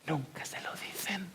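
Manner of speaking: dropped to a bare whisper. Real, not acted. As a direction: breathe out the sad truth. Sermon, sorrowful point. (susurro apenas audible)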